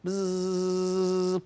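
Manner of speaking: long, steady voiced buzz; imitated buzz. Shown as a demonstration. Teacher buzzing round the board in a letter game, steady buzz. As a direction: buzz like a bee on one note.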